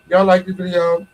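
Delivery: usual speaking voice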